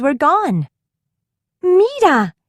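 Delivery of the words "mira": high excited call; cried out. A narrator's reading. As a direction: call out Mira with delight. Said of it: "cried Elena".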